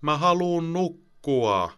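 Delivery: put-on high, slow, whining child's voice; whiny child. Tired boy whines to his mother that he wants sleep.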